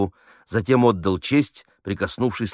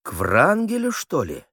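high, startled suspicious query; suddenly on guard, pitch jumping up; wary, alerted; 9 semitones higher than usual. A narrator's reading. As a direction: prick up your ears and ask sharply. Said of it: "настораживался Геша".

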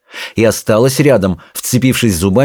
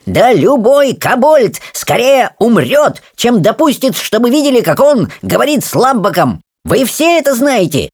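high, squeaky indignant protest; offended dignity; 13 semitones higher than usual. Acted. Rolla defends kobold honour, high affronted protest. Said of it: "с видом оскорблённого достоинства".

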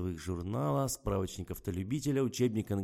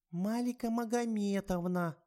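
put-on much higher, slow little-girl squeak; thin squeak; 12 semitones higher, slower than usual. A narrator's reading. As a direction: pipe it like a small girl. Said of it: "тоненько пропищала внучка".

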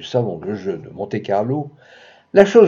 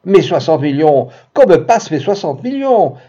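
high, louder excited cry; cried out; 5 semitones higher, 5 dB louder than usual. A narrator's reading. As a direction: demand the winnings eagerly. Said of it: "Je m'écriai".

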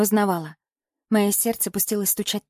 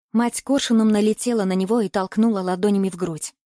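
ordinary delivery, nothing marked

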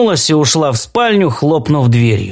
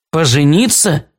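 ordinary delivery

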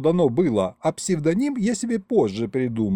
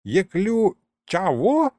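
put-on high baffled old sorcerer; the old ghost lost on an unfamiliar word; puzzled ancient ghost; 8 semitones higher, 3 dB louder, slower than usual. A narrator's reading. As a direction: stumble over the word.

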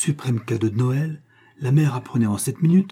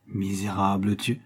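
plain reading voice

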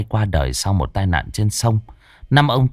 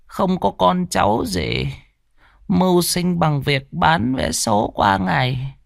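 put-on higher, slow, frail old lady voice; frail old woman; 7 semitones higher, slower than usual. A narrator's reading. Old lottery seller answering Trương, higher frail old voice. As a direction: speak slowly like a lonely old lottery seller.

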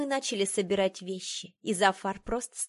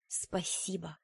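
soft, restrained thanks; hushed, quietly defiant; quiet but defiant; 7 dB quieter, slower than usual. A narrator's reading. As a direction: say it softly with a challenge underneath. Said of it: "сказала я тихо, но с вызовом".

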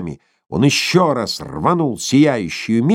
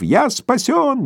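jubilant; slower than usual. Acted. Korotkov rejoicing.

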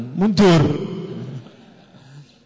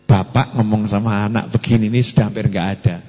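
ordinary delivery

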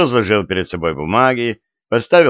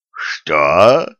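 shocked, breathless "what"; gasping in shock; 4 semitones lower, 3 dB louder than usual. A narrator's reading. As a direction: gasp it out, caught off guard. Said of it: "ахнул палач". (палач ахает от неожиданности)

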